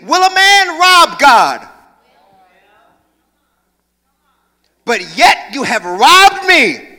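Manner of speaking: booming slow voice of God. Acted. Preacher booming God's question to the church. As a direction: thunder it out as God himself.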